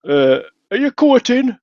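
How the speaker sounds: put-on high, slow old grandma voice; imitating a nosy grandma. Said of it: "my grandma would say".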